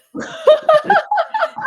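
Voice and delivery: high delighted laugh bursting out; burst of laughter. Real, not acted. Host laughing at her own survey story, big high laugh. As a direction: laugh out loud at yourself.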